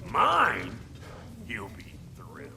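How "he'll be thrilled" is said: dry whispered aside; sarcastic whisper. Acted.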